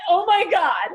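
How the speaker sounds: high, loud gasp of horror; panicked exclamation. Retold or imitated. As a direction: blurt it out mortified. Panicked reaction.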